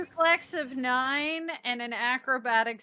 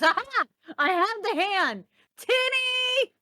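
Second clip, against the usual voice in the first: a bright triumphant cry, pitched up; 5 semitones higher, slower than usual. Acted. Ray triumphantly calling the dragon Tinny, higher and bright. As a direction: flourish the find like a prize. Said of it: "pats her pocket and goes".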